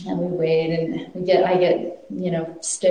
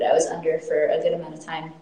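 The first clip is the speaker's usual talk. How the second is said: usual speaking voice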